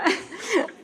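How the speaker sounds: a burst of laughter; laughing. Real, not acted. Laughing at her acting.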